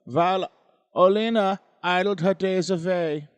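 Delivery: put-on theatrical, swooping Eastern European accent; mock Ukrainian accent. Reading the ghost story aloud, hammed-up Ukrainian accent. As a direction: ham it up in a bad Ukrainian accent, bursts high and loud then dropping. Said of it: "you made me do the accent".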